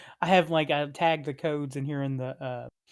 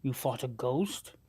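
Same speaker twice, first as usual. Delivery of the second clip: gravelly sly hobgoblin; 2 semitones lower, 6 dB quieter, slower than usual. Acted. DM voicing hobgoblin.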